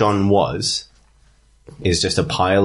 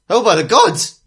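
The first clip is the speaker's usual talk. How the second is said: high exclamation of horror, acted; panicked bystander calling out; distant alarmed cry; 11 semitones higher, 4 dB louder than usual. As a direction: cry out higher in shock. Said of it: "you just hear".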